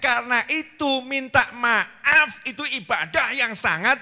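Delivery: ordinary talk, nothing marked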